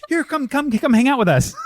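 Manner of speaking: high, excited mock invitation; eager beckoning. Voicing her welcome to the fourth person, eagerly beckoning. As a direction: wave them over with the voice.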